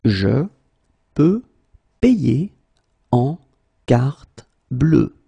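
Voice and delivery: long pause after every word; slow, word by word. Shown as a demonstration. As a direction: say Je, peux, payer, en, carte bleue separately. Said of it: "teacher drills word by word".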